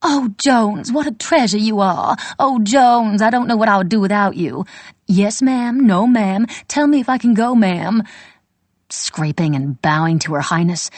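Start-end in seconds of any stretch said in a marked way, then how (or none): 0.0-8.0 higher, louder sugary mistress voice, hammed up; mocking exaggerated drawl. Maid mimics her employer in an exaggerated drawl. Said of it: "her voice taking on an exaggerated drawl".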